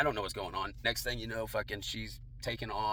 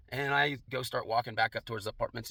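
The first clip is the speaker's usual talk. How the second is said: normal talking voice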